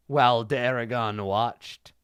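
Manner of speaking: ordinary talk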